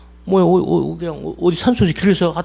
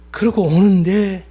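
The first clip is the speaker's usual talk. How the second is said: usual speaking voice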